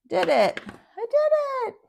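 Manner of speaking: high, loud little victory cheer; triumphant. Real, not acted. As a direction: cheer as the lid pops. Lid finally opens.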